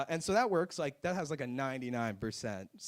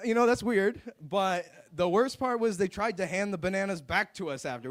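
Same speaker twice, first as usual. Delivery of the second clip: ordinary talk, nothing marked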